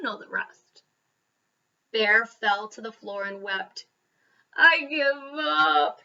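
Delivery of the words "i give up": slow, wailing sob-voiced surrender; blubbering. A narrator's reading. Bear gives up to the mouse, blubbering. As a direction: wail it out, defeated. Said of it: "he blubbered".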